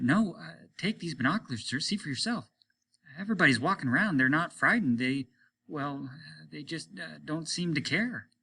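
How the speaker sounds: a hint of a nervous officer; hesitant officer. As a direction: fumble for words. Martin hesitant.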